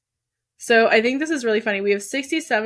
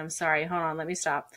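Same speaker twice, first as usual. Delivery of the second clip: ordinary talk, nothing marked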